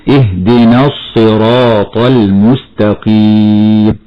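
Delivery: slow, the alif of اهدنا dropped and linked into الصراط; careful Quran recitation; connected recitation. Shown as a demonstration. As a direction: run اهدنا into الصراط without the long a. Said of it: "هكذا مرة أخرى".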